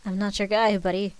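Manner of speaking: loud mock Canadian accent; lightly hammed-up bad Canadian; worse Canadian accent. A narrator's reading. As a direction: retort in a badly faked Canadian accent. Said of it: "in an even worse Canadian accent".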